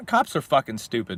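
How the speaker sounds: normal talking voice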